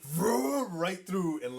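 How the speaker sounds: loud mock engine vroom. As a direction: rev it like a truck. Imitating truck engine.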